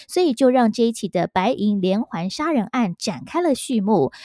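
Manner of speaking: ordinary delivery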